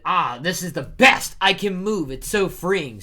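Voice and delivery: loud, high cry of joy; exultant. Acted. Loki relishes finally being able to move, exultant.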